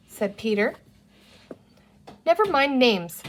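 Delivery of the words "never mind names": grim. A narrator's reading. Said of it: "said Penny more grimly".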